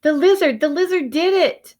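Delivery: high, loud delight; joyful shout. A narrator's reading. Alexander telling Willie joyfully, high and loud. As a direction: burst out with glee.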